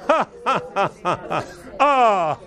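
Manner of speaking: bursting high laugh; joyful laughter. Real, not acted. Preacher breaking into holy laughter, joyful laugh.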